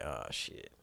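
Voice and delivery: unvoiced, low under-the-breath; whispered oh shit. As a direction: breathe it out in dread. Boyfriend speeding into lot.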